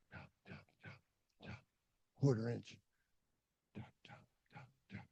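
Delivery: soft voiceless tap sounds; whispered tapping. Retold or imitated. Climber miming careful hammer taps, whispered. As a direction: mouth the taps of a tiny hammer.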